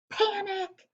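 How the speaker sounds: high, frightened little chick's yelp; scared schoolchild cry; panicked cry. Acted. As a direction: squeak it out in sudden fright. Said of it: "Chicken Little got scared".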